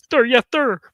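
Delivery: mock military shout. Mocking the strict band director, loud mock salute. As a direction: bark it like a recruit, hammed-up.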